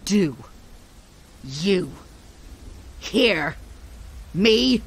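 slow, punched, menacing; word-by-word threat. Acted. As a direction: hammer every word apart. Mother spacing words in fury.

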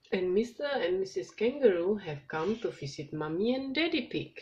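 ordinary delivery

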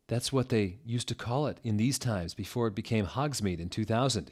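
ordinary delivery, nothing marked

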